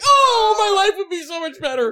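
very high, mock-wailing wish; hammed-up longing. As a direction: wail it high like an overdone daydream. Voices a wistful wish, exaggerated.

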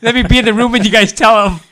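very loud, high mock plea; over-the-top eager begging; gleeful begging. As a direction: shout it with wicked delight, hammed up. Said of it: "he says".

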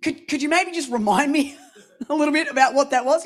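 hesitant, giggling, caught out; sheepish laughing stall. Retold or imitated. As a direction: laugh through the awkward excuse. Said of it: "And I'm like".

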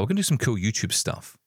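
ordinary talk, nothing marked

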